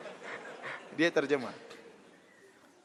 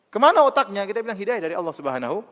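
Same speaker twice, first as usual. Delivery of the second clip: louder and higher, hammering each phrase; forceful preaching; 5 semitones higher, 5 dB louder than usual. Acted. Preacher scorning clever idol worshippers, loud and rising. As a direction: build up the outrage before the punchline. Rhetorical outburst in sermon.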